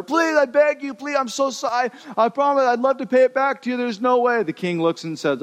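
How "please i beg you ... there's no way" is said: begging debtor. Acted. Servant pleads with the king to go free, high whiny begging. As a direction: beg the king for mercy.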